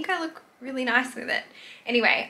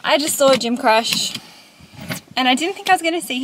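excited; 3 semitones higher, 8 dB louder than usual. Real, not acted. Excited news about gym crush.

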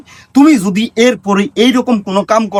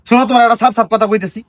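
ordinary talk, nothing marked

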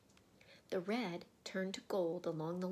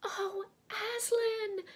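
very high, loud, drawn-out cry; 7 dB louder, slower than usual. A narrator's reading. Children see Aslan alive, high amazed cry. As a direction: cry out his name in amazement. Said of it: "cried both the children".

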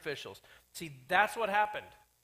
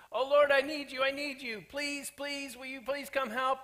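higher, loud, begging plea; a person in trouble begging the Lord, high and loud; desperate pleading; 7 semitones higher, 5 dB louder than usual. Acted. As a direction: beg God to rescue you. Voicing a desperate prayer.

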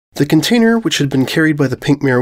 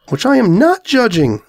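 much higher, lightly voiced insistence; valiant dragon; 11 semitones higher than usual. Dragon insisting to the orange, high and firm. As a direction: stand firm on a point. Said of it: "the dragon valiantly insisted".

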